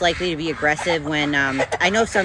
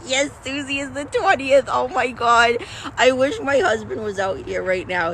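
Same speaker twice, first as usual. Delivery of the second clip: laughing relief; 8 semitones higher than usual. Real, not acted. Embarrassed relief after miscounting.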